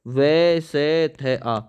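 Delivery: slow dictated letters; slow spelling out. Shown as a demonstration. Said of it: "दुर लिखो पहले". (धीरे-धीरे वर्तनी बताते हुए)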